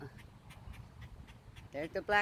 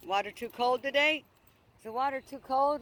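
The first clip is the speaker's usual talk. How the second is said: ordinary talk, nothing marked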